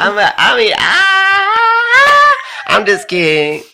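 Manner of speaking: very high, loud put-on shout; Chris Hills impression. Guest keeps up his friend's impression, shouting high. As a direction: belt it out high as the character. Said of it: "the best Chris Hills impression".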